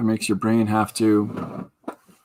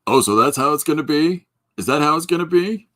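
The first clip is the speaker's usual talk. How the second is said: higher imitation, climbing to each question; Gary Hodges; 4 semitones higher, 4 dB louder than usual. Showing off an impression for the viewers, high challenging questions. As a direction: pitch it up, challenge with rising questions. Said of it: "Here's my Gary Hodges impression".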